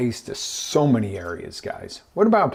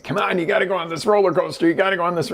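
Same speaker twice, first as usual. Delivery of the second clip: quick, higher-pitched badgering; pushy urging; 7 semitones higher, faster than usual. Friends pressuring you at the park, pushy urging. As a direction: egg them on, fast and eager.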